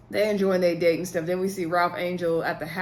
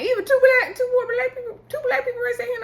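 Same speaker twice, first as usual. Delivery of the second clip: much higher, louder outraged exclamation; shocked disbelief; 3 dB louder, faster than usual. Real, not acted. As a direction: squeal it in outrage. Outraged at the vote.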